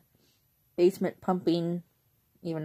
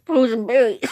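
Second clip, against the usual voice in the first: louder, higher, punching the words out; emphatic loud repeat; 6 semitones higher, 12 dB louder than usual. Real, not acted. Repeating her favourite drink loudly for the viewers, emphatic.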